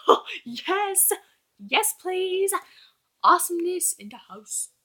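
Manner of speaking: high, thrilled cheering; squealing with glee at the twist; excited squeal. Retold or imitated. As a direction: gush like a delighted fan. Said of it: "I was like".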